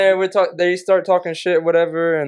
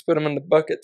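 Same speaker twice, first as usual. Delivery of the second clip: ordinary talk, nothing marked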